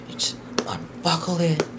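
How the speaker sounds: breathy whisper as she unbuckles it; a secret aside while unbuckling. Real, not acted. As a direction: hush it, just air, no voice. Demonstrating the buckle.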